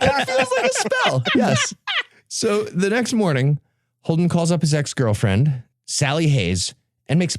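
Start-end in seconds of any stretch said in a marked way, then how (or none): 0.0-1.7 much higher, joking put-on voice; mock high voice. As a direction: squeak it out as a silly joke.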